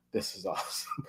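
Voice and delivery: hushed, breathless whisper of delight; awed whisper. Retold or imitated. Opening a long-awaited package, a delighted whisper. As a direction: whisper it like you can't believe your luck. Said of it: "And I was like".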